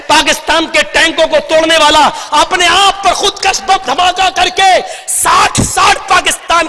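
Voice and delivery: impassioned rally speech with ringing emphasis; fiery oratory. Real, not acted. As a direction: address the crowd like a fiery orator. Impassioned political speech to a crowd.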